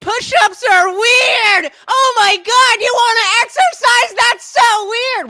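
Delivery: shrill high mock yell; mocking high-pitched shout. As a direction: screech it like a mocking troll.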